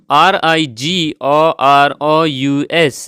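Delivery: slow letter-by-letter spelling; spelling aloud for learners; spelled out. Shown as a demonstration. Spelling out the word.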